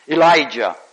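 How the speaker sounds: loud, high preaching climax; thundering. Acted. Preacher extolling Elijah's might, loud and thundering. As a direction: let it ring out like fire from heaven.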